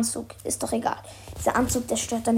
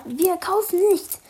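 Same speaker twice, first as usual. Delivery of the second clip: growled; 7 semitones higher, 3 dB louder than usual. Acted. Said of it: "grollte der Komplize".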